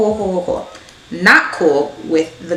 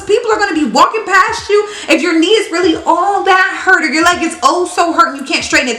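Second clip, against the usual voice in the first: exasperated rant; 10 semitones higher than usual. Real, not acted. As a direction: vent in total disbelief. Ranting at the lady.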